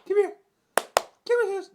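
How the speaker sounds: high loud call to the dog. Real, not acted. Calling the dog.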